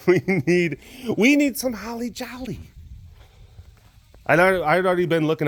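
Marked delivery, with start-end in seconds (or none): 0.1-2.6 high, comic mock-desperate voice; playful high-pitched begging; hammed-up festive plea. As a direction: squeeze it out high and silly. Acting out his festive plea.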